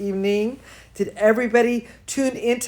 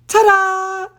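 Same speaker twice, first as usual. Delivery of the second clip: ta-da flourish; 10 semitones higher, 4 dB louder than usual. A narrator's reading. Grand entrance announced.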